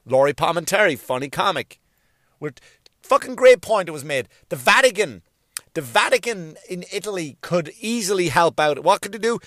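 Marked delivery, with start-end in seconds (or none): none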